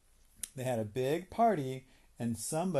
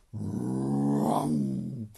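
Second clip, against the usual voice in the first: low rumbling engine roar; low engine vroom; 9 semitones lower, 4 dB louder than usual. Acted. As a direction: rev it like a big engine. Bulldozer engine noise in story.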